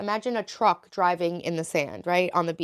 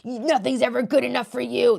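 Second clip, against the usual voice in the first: a hint of a reproachful parent, higher and louder; parents' voice; 6 semitones higher, 6 dB louder than usual. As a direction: say it like a parent who is never satisfied. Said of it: "That's, like, my parents' voice".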